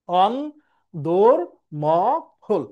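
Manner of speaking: অন, দোর, ম, হল as four separate beats; syllable-by-syllable pronunciation. Shown as a demonstration. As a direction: dictate অন দোর ম হল with a clear pause after each syllable. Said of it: "এটা হচ্ছে উচ্চারণ".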